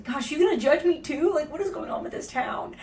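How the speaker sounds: high, loud exasperated protest; mock indignation. Solo customer to the ticket girl judging her, mock indignant. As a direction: complain in theatrical outrage. Acted protest at being judged.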